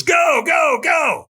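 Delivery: high repeated shout. Retold or imitated. The trainer shouts at her to walk the coals. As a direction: yell her forward.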